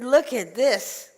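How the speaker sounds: loud, high, drawn-out words; exasperated demand. Acted. Old woman shows rabbi her house, exasperated.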